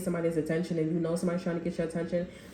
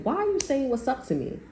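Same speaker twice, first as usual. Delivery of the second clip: offended surprise; 7 semitones higher than usual. Retold or imitated. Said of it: "this person's like".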